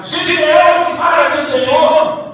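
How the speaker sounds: high-pitched warriors' shout; battle cry. Acted. Preacher voices the three hundred surrounding the enemy, shouted cry. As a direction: cry it out to rout an army. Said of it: "quando aqueles trezentos gritaram".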